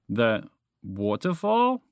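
slow, rising disbelief; stretched-out incredulous echo. Acted. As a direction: draw it out into a baffled question. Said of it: "asked incredulously".

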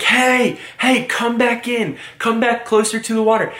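put-on high, strained call to the kids. Lifeguard calling cousins back to shore, high mock yell. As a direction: yell them back from the water. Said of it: "So I start screaming".

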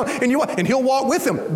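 ordinary talk

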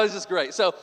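normal talking voice

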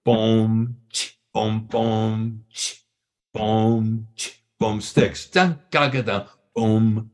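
beatbox the song's drum intro; vocal drum imitation, boom ch boom; drum beat vocalized. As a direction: mouth drum pattern, boom and ch. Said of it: "and it goes".

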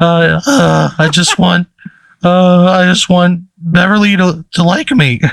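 high, hesitant adolescent voice; cracking teenage boy. Acted. Game master voicing a boy's wish about a crush, high cracking voice. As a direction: pitch it up like a lovesick teenage boy. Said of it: "a teenage boy's voice, it, like, cracks".

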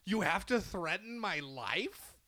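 very high, loud, indignant squawk; exasperated protest, laughing through it; shrieking high at the unfairness; outraged disbelief. Retold or imitated. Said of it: "And I'm like".

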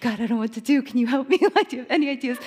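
ordinary delivery

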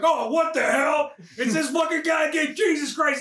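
high, acted outburst of exasperation; exasperated cop. Cop seeing the same guy, exasperated outburst. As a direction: play a fed-up cop crying out.

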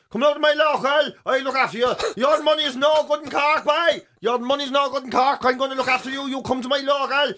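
lightly put-on Cork accent, pushy and generous; Cork lad imitation. Comedian imitating the Cork lad who offers to look after him, in a Cork accent. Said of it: "one of the lads in Cork, lovely fella, going".

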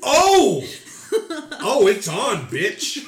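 loud, high laughing yell; mock yelling with laughter, fired up; gamer hype. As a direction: shout it like a gamer ready to fight. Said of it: "And you were like".

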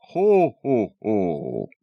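booming Santa laugh, starting high and falling; hearty, loud Santa entrance; bellowed ho-ho-ho. A narrator's reading. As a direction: bellow it like Santa coming down the hall. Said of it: "bellowed from the hallway".

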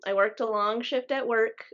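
usual speaking voice

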